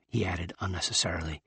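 ordinary delivery, nothing marked

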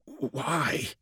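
a dying breath of a question; barely voiced, hurt; faint. A narrator's reading. Dying custodian asks his king.

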